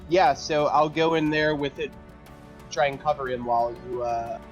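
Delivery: ordinary talk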